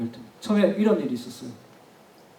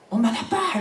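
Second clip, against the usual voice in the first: high-pitched imitated yell, held back in volume; mock child's yell; 6 semitones higher, 4 dB quieter, slower than usual. Child yelling at adoptive mother before everyone, mock yell. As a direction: imitate a child crying out, without real loudness. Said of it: "소리쳤어요".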